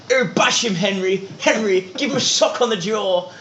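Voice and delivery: high, jeering posh lads egging on; posh rugby team urging their mate to fight, hammed-up. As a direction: egg Henry on like a rowdy crowd.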